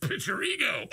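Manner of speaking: sneezing mid-sentence; words broken by a sneeze; sneeze burst. Real, not acted. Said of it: "Oh, sorry, excuse me".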